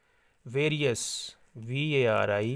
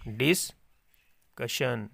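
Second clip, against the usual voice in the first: slow dictation word; much slower than usual. Shown as a demonstration. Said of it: "slow dictation of word".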